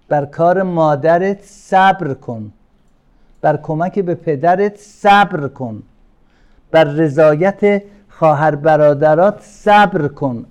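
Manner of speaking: slow, rising to loud exhortation; preaching build. Acted.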